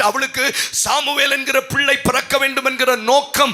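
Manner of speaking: loud impassioned preaching over music; fervent Tamil pulpit preaching; preaching at full force. Acted. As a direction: preach it hard, ringing out to the hall.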